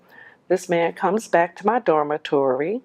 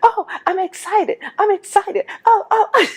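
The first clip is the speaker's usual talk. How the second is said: mock excitement, much higher and laughing; squealing fake delight, laughing through it; sarcastic. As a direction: overplay the thrill to tease him. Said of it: "to be sarcastic".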